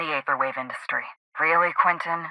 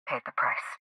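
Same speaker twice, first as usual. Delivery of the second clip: softer, breathed-out words of grief; grieving whisper; 5 semitones lower, 12 dB quieter than usual. Acted. Sister telling the inspector of her twin's death, whispered. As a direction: let it fall away to a whisper.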